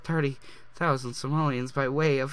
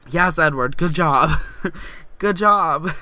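much louder cheering praise; 3 semitones higher, 10 dB louder, slower than usual. Real, not acted. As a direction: cheer him on at full volume. Cheering her Sim on.

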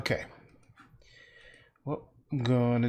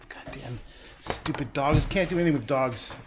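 louder, exasperated complaint; frustrated outburst; 4 semitones higher, 7 dB louder than usual. Real, not acted. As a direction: vent at the useless dogs. Frustrated the dog won't cooperate.

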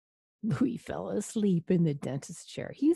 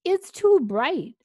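high, loud, drawn-out complaint; yell; 11 semitones higher, 9 dB louder, slower than usual. A narrator's reading. Vampire under the dentist's light, high yell. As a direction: yelp it out in storybook protest. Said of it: "Louis yelled".

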